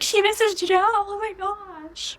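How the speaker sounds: high, drawn-out gasp of delight; excited squeal. Retold or imitated. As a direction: squeal it like a fan. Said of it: "I was like".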